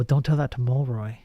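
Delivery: ordinary talk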